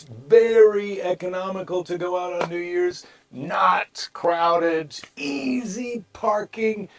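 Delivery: oversell every perk in a put-on voice; mock sales pitch.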